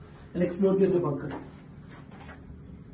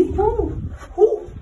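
excited; 14 semitones higher, 6 dB louder than usual. Real, not acted. Admiring view inside bunker.

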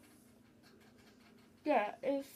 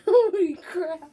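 loud, slow, mouth full; amazed through stuffed cheeks; surprised; 6 semitones higher, 12 dB louder, slower than usual. Real, not acted. Shocked by dad.

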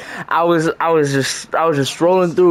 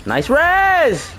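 excited cheer; 12 semitones higher than usual. Real, not acted. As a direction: pitch swoops high on "res". Cheering a teammate's revive.

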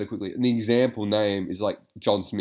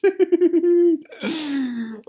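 laughs through it, much higher and louder; laughing mimic; 10 dB louder, much slower than usual. Vlogger mimicking the caught man's excuse, laughing. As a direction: parrot his excuse while cracking up.

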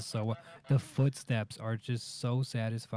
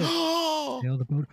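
slow, high, drawn out; 13 semitones higher, much slower than usual. Pretend. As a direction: stretch it out high.

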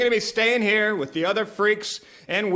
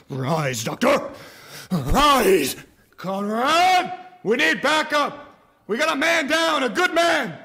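shouting; 7 semitones higher than usual. Acted. Calling for help, man down.

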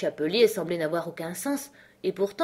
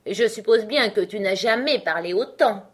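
mildly disdainful, louder delivery; scornful; 4 semitones higher, 6 dB louder than usual. A narrator's reading. Hatter puts Alice down, with scorn. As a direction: look down on the listener. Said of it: "d'un air de mépris".

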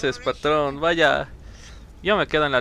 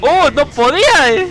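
being chased; 10 semitones higher, 6 dB louder than usual. Real, not acted. Caught while fleeing.

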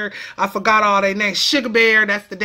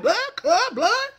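laughing mock gang shout; 9 semitones higher than usual. Gang call-outs, laughing.

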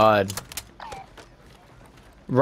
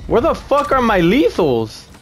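frustrated yell; 8 semitones higher than usual. Real, not acted. Frustrated, missing gear.

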